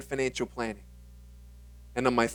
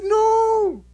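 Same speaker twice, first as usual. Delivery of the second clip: high indignant no; 5 dB louder than usual. Retold or imitated. Teen denying.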